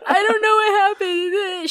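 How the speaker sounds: high-pitched, loud panicked wail; hammed-up bawling self-imitation; mock crying. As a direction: protest in an exaggerated tearful whine. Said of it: "I started crying".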